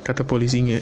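normal talking voice

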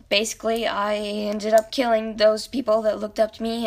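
ordinary talk

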